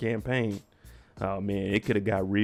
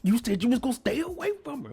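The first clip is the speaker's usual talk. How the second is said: high, strained mock rant at Will; imitating Carlton blowing up, voice pitched way up; furious cousin confronting Will, high and raw; Carlton going off. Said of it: "going off on Will".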